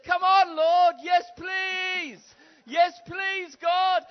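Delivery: very high shouting "Yes, please"; shouted plea. Pretend. Congregation eagerly asking God for miracles, shouted yes please. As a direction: beg God at the top of your lungs. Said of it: "It says".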